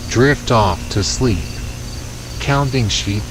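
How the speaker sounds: ordinary delivery, nothing marked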